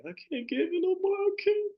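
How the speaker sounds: high, cracking voice on the edge of tears; breaking down crying. Retold or imitated. Retelling the medicine ceremony, she breaks down crying. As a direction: let the voice break as you give up. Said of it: "I started breaking down, crying".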